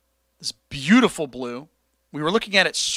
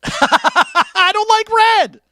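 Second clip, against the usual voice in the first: very high, loud, drawn-out silly cry; cackle, then stretch the words out high; mock-maniacal squeal, slow and loud; hammed-up laughing outburst; 5 dB louder, much slower than usual.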